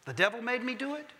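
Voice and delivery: a quick nod to the comedian's line; Flip Wilson catchphrase. Retold or imitated. Preacher to congregation, light Flip Wilson catchphrase. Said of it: "Flip Wilson".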